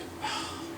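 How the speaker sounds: long, soft exhale; weary sigh. Retold or imitated. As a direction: sigh at the alarm, worn out. Alarm goes off, wordless reaction.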